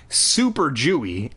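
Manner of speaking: ordinary delivery, nothing marked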